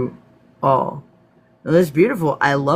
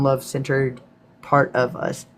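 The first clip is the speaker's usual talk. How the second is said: usual speaking voice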